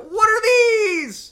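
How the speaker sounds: very high, loud, drawn-out shriek; hammed-up wailing yell; mock freak-out scream. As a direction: shriek it slowly in exaggerated bewilderment. Mock freak-out at warm feelings.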